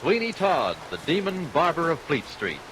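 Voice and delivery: ordinary delivery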